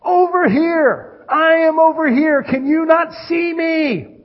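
high, loud shout for attention; God calling out. Acted. God trying to get his people's attention, shouting. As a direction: holler slowly across a crowd, urgent. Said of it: "It's like God's saying".

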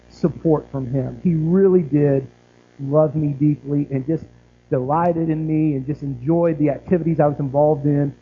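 normal talking voice

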